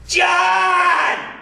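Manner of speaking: high shouted name, calling across a store. Retold or imitated. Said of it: "Dave yells after me".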